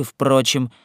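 ordinary delivery

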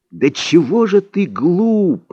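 slow, high-pitched exasperation; exasperated. A narrator's reading. Don Juan answering his naive pupil, exasperated. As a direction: stretch it out in dismay at the pupil.

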